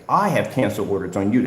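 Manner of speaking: usual speaking voice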